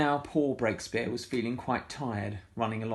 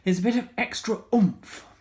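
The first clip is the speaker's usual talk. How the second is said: much higher, louder, punchy; excited oomph; 11 semitones higher, 8 dB louder than usual. Acted. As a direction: burst out with gusto. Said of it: "said Missus Armitage".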